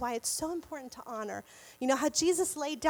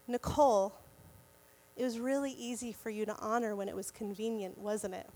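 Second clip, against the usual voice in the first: God's fatherly voice; 6 semitones lower, 5 dB quieter than usual. Retold or imitated. The Lord speaking to Nicole in the car, lower, soft and fatherly. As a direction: lower and softer, a calm fatherly rebuke. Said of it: "I hear the Lord say".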